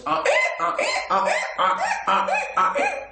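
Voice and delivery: mocking the doctor with donkey hee-haws; donkey braying. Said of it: "I kept on making the donkey noises".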